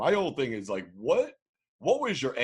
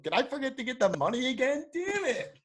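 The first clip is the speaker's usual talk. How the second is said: high, fast ditzy voice; high ditzy mock dismay; ditzy hooker; 13 semitones higher than usual. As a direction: squeak it like she just remembered. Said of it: "She's like".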